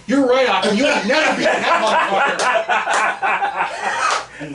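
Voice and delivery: high, loud, laughing yell; high, loud laughing concession; laughing shout. Retold or imitated. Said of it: "I go".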